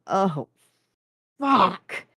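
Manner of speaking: halting curse, breaking on the last word; pause, then let the curse quaver out; quavering dread. A narrator's reading. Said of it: "with a quaver that made all".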